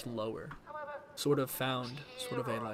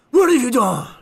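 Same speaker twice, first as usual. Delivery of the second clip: much higher, much louder shout; Battinson yelling; 9 semitones higher, 12 dB louder than usual. Retold or imitated. Gamer imitating Batman's shouted line. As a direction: shout it out as Batman finally snaps. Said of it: "he doesn't really go higher until he's like".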